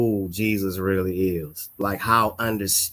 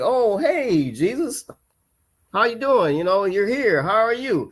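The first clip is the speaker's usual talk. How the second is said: delighted greeting; 9 semitones higher than usual. Retold or imitated. Greeting Jesus, retold.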